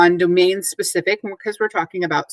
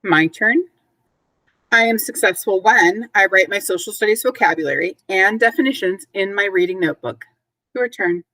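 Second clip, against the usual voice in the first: teacher call and response. Acted. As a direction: lead the class line by line.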